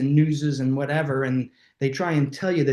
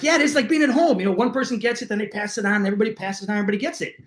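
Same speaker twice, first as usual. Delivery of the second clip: mocking roommates; 6 semitones higher, much faster than usual. College roommates lecturing [name] about bugs, high mocking mimicry.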